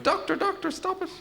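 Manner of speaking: very high mock-helpless squeal; tickled squeal. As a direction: protest in a tiny high voice while being tickled. Acted patient being tickled, protesting.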